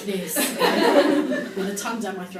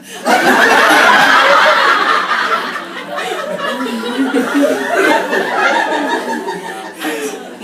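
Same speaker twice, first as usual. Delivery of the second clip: loud, high, drawn-out laughter; long howl of real laughter; long helpless laugh; 12 semitones higher, 12 dB louder than usual. Real, not acted. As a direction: collapse into laughing at yourself. Laughing at her diary entry.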